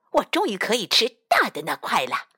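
plain reading voice

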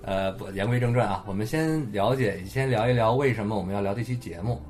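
ordinary talk, nothing marked

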